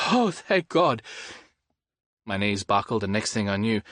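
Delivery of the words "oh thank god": high, breathless relief; relieved gasp. Acted. Hearing Jules is okay, high relief. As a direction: let it all out.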